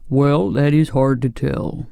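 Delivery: solemn, level pumpkin-man character voice; grave. A narrator's reading. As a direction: speak gravely with little movement in pitch. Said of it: "to hear his grave voice".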